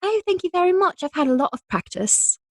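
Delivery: high, polite British accent. Shown as a demonstration. Actress thanking host for the praise, high British accent. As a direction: answer a compliment in a put-on British accent. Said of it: "little bit of a British accent".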